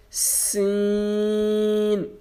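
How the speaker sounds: سین drawn out like a whistle; whistling, long سین; stretched Seen. Shown as a demonstration. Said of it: "کھینچ کے پڑھنا ہے".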